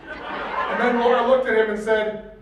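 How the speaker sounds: usual speaking voice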